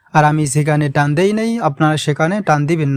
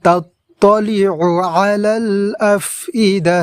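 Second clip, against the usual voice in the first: slow melodic recitation; Quran recitation; 2 semitones higher, slower than usual. Shown as a demonstration. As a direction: chant the verse beautifully and slowly. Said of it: "এই আয়াতটি আমরা সুন্দরভাবে এইভাবে পড়ব". (সুন্দরভাবে ধীর তেলাওয়াত)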